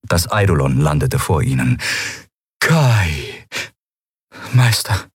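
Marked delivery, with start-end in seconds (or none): 2.6-3.5 long drawn-out call. A narrator's reading. Eidolon spots long-lost Kai, calling his name drawn out. 4.5-5.1 hushed, stunned half-whisper. A narrator's reading. Kai meets the master's spirit, whispering. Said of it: "flüsterte Kai".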